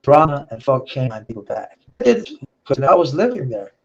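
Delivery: ordinary delivery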